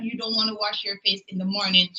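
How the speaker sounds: normal talking voice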